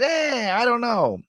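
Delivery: high, drawn-out "eh"; imitated reluctant son; mock reluctant whine. Acts out himself whining to father.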